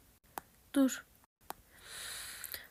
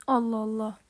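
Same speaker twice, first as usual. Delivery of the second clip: normal talking voice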